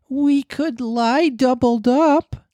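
put-on high, very slow eager boy voice. A narrator's reading. Nibs offering to squeeze in, slow high boy voice. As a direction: Nibs offering helpfully, drawn out. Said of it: "said Nibs".

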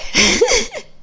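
short high-pitched laugh. Real, not acted. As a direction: burst into a brief, high laugh. Laughs over her bad luck.

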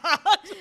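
loud high laugh; burst of laughter. Real, not acted.

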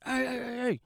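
high, rapid-fire mock calling out; intervening coach. Coach stepping into a fight, retold as high calling out. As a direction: imitate a coach breaking it up. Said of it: "And the coach was like".

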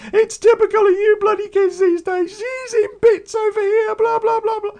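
high, loud nagging mum voice; mock shrill mother telling him off; imitating her mum. The mum scolding him on the phone, high, loud and put-on. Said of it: "And her mum's going".

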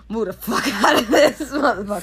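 loud, high, cracking up mid-sentence; laughing. Real, not acted. Parent joking about kicking the kid out, laughing. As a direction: burst out laughing through the words.

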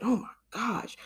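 breathy whisper of disbelief. Retold or imitated. Reacting to a friend's tag, whispering disbelief. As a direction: hush it like you can't believe it. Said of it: "and I was just like".

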